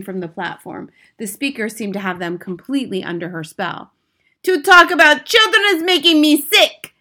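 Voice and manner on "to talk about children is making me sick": high, loud disgusted scream. Acted. Grand High Witch screaming her disgust at children, loud. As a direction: scream it as if sick at the thought. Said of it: "screamed the Grand High Witch".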